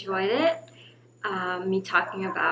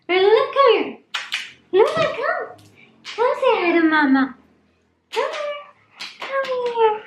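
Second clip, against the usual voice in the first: very high, loud sing-song pet voice; high cooing pet talk to her dogs; 7 dB louder than usual. Real, not acted. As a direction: coo up high at the puppies like they're babies.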